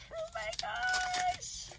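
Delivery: very high, slow squeal; thrilled squeal. Real, not acted. Learning who sent the gift, thrilled squeal. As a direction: stretch it out in high delight.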